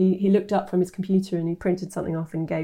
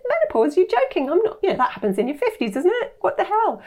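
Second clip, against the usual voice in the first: much higher, mock-incredulous outburst; shocked disbelief; 11 semitones higher than usual. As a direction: replay the moment the news floored her. Retelling disbelief at dad's news.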